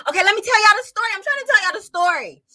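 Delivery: high, loud and fast, still laughing; laughing while trying to start the story; laughing restart. Real, not acted. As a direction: pull yourself together, giggling.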